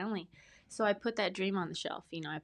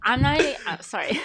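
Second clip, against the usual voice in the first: loud, higher, through a laugh; 7 semitones higher, 14 dB louder than usual. Real, not acted. Apologising to the host while laughing loudly. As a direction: break up laughing.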